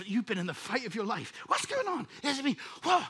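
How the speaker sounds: usual speaking voice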